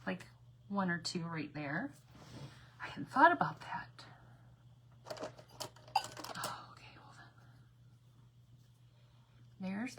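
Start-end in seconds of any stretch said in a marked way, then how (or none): 2.8-7.3 whispered aside. Real, not acted. Aside while crafting.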